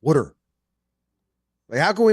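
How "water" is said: loud Philly "Water", said "wooder"; blunt Philly accent, falling. Shown as a demonstration. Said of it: "a dumpster talking".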